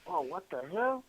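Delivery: high, startled "what the hell"; mock-startled at waking up late; surprised. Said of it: "I was like".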